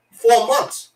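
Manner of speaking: put-on loud, high Hong Kong accent. Restaurant man giving the wait time, loud Hong Kong accent. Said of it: "Hong Kong restaurant".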